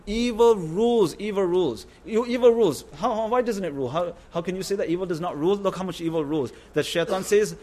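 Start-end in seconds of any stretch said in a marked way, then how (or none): none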